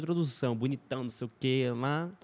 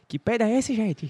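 high, mock-outraged singer voice; 9 semitones higher, 3 dB louder, slower than usual. Imitating the singer reacting to the smell at the show, high and mock-outraged. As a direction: squeal it like a shocked star. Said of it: "se o [name] tivesse ali".

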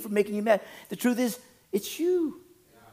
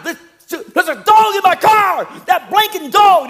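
high, loud hammed-up outrage; mock cussing; 8 dB louder, slower than usual. Driver cussing at a dog in his car, mock furious shout. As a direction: imitate a man yelling at a dog. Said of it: "he started cussing at the dog".

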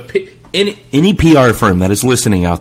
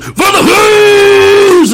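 slow, high, loud hammed-up war cry; mock battle cry; 6 dB louder, much slower than usual. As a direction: bellow it like a charging soldier. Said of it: "and he just goes".

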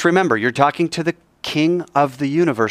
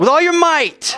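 with gusto; 3 dB louder than usual. Shown as a demonstration. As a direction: lead the congregation in a full-voiced prayer call. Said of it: "say it with some gusto".

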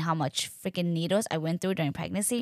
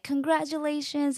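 high, cheery singsong; imitating delighted hospital staff; excited congratulations; 8 semitones higher than usual. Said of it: "so positive and so excited".

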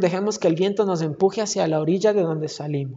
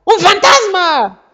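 high, loud terrified cry of a ghost; frightened scream; 10 semitones higher, 8 dB louder, much slower than usual. Retold or imitated. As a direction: shriek it in panic at a ghost. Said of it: "empezaron a gritar, a gritar".

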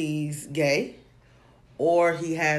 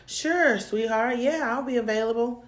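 high sugary quoting of his promise; mock sweet reply; 7 semitones higher than usual. Imitating the man's eager reply, high and sugary. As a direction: sing it up high, too sweet. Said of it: "I was like".

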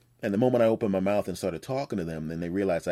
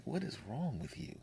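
disgusted onlookers; 4 semitones higher, 13 dB quieter than usual. Retold or imitated. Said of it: "people in the store looking at me like".

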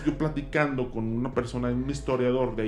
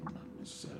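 awed whisper; 17 dB quieter than usual. Retold or imitated. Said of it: "se quedaron así de".

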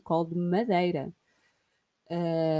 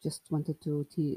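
normal talking voice

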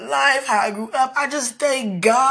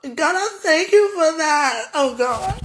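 real crying prayer, pitched higher; tearful thanks; 6 semitones higher, 3 dB louder than usual. Real, not acted. Speaker thanks God for restoration, crying. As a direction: thank God through tears, voice lifting.

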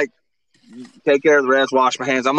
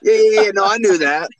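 higher, quick, flustered backpedal; embarrassed cover, voice up high; mock defensive cover-up; 7 semitones higher than usual. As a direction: pretend you knew it all along. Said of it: "And I was like".

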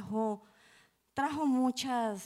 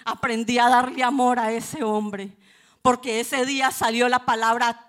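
preaching at full force; 3 semitones higher, 9 dB louder than usual. Real, not acted. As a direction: cry it out to set the church free. Predica con fuerza. (predicando a toda voz)

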